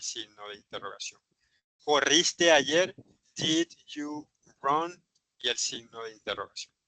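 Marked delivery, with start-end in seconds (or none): 1.8-2.9 "Corriste ayer" slow and louder, lifting at the end; teacher's slow model question on "Corriste ayer"; question intonation. Shown as a demonstration. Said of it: "sino en pregunta". 3.4-5.0 "Did you run" word by word, slowly, rising on "run"; slow teacher's model of "Did you run" as a question; question intonation. Shown as a demonstration. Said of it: "sino en pregunta".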